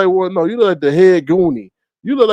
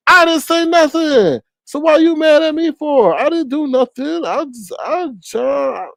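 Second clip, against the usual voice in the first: much higher whiny protest; mock innocence; 10 semitones higher than usual. Streamer mock protesting to a rival, high whiny innocence. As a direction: squeal it like a wronged child, hammed-up.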